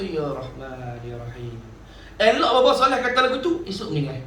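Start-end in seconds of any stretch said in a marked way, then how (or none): 0.0-1.6 low, much softer, fading prayer; faint dying words. Retold or imitated. Dying teacher whispers God's names on his last night, low and faint. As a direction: breathe the names out like a dying old man.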